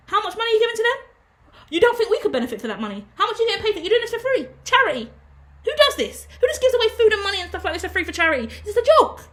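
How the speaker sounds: high, whiny mimicry of a doubting partner; scoffing at her charity in a high put-on voice; mocking nagger voice. Voicing the person who nagged her.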